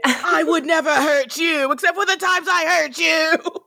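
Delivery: whiny mock apology; slow and high, fake tearful. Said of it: "mocking fake apologies".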